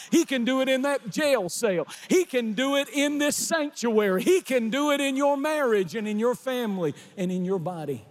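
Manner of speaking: high, driving, rhythmic preacher's cadence; full-force preaching. Real, not acted. Preacher urging the congregation that he can do it anywhere, full-force preaching. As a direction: preach it with mounting urgency.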